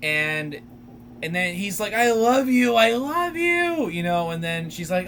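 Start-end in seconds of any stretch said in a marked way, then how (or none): 1.9-3.9 imitating a lovesick guy, stretched out; retold gushing declaration. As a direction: gush it, rising higher on the repeat. Retold movie line, gushing love.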